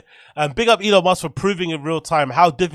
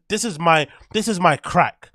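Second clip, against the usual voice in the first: ordinary delivery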